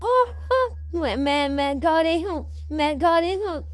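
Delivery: high cartoon voice insisting on her name; a scared little bird begging to be recognised; pleading ghost. (ऊँची आवाज़ में गिड़गिड़ाती गौरी)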